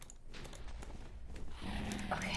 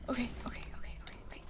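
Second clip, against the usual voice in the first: soft, breathless whispered okays; whispered panic; 6 semitones lower, 21 dB quieter, much faster than usual. Real, not acted. Panicked muttering.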